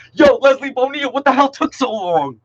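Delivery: high, loud playful yo; mock-scold a late arrival with delight; excited greeting. Real, not acted. Teacher cheerfully teases a student who joined late, excited. Another student finally joins.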